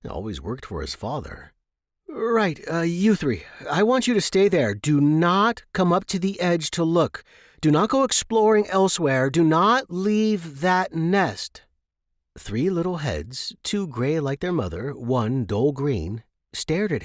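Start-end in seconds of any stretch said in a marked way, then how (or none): 2.1-11.6 higher, louder would-be stern parent; Jeremy sternly ordering the hatchlings to stay put; Jeremy, mock stern. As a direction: lay down the rules like a strict father, slowing on the last. Jeremy plays stern father.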